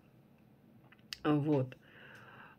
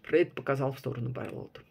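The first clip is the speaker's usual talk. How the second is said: usual speaking voice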